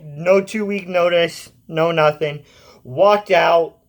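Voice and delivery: normal talking voice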